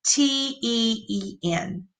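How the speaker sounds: name each letter softly; spelled out softly. Shown as a demonstration. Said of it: "added the four letters".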